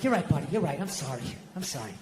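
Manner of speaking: low, fading mumble of contrition; deflated dad saying sorry; chastened apology. Retold or imitated.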